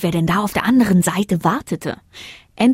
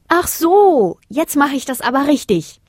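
put-on high, eager little-girl reply; a loud high "ah" then keen resolve; sudden realisation; 8 semitones higher, 3 dB louder than usual. A narrator's reading. Girl to the moon, ready to retry, eager realisation.

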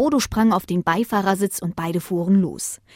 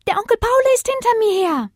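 very high, loud little voice; high-pitched child-like alarm; little puppy; 6 dB louder than usual. Acted. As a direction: tell on Uncle like a tiny pup. Pup complains of being chased.

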